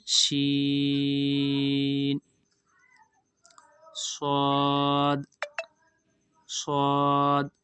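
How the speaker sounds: शीन and स्वाद held long and heavy; drawn-out letters. Shown as a demonstration. Teacher sounding out letters slowly for students. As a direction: stretch स्वाद slowly from the throat. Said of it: "हलक में से आवाज़ आनी चाहिए".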